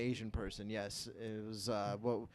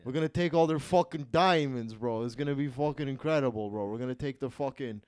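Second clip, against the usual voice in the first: loud Jersey Italian bro as Mussolini; Jersey Italian accent; 3 semitones higher, 5 dB louder than usual. Retold or imitated. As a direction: hype it up, Jersey-style Mussolini. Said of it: "I feel like I'm talking to Mussolini".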